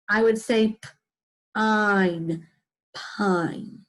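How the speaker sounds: pine built from p- and ein, vowel held long; teacher blending pine; sounding out. Shown as a demonstration. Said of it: "if I sound this out".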